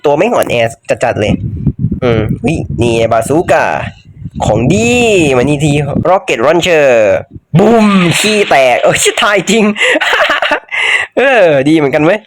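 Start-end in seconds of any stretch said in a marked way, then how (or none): 4.4-6.9 drawn-out announcement. Real, not acted. Gamer grabs a bazooka. 9.7-11.1 high laugh. Real, not acted. Laughs at his own explosion.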